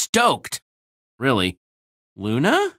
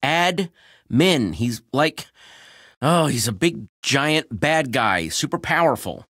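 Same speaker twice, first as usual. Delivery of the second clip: usual speaking voice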